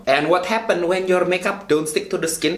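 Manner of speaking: big-city Indonesian street-slang English; Javanese-mixed Indonesian accent. Shown as a demonstration. Vlogger teaching viewers about primer, Javanese-mixed Indonesian accent. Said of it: "the big city Javanese mixed accent".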